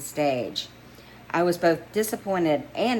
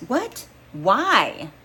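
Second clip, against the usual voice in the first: high, startled what, then a louder why; surprised; 6 semitones higher, 5 dB louder than usual. Acted. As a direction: caught off guard, protesting. Friend stunned she's leaving.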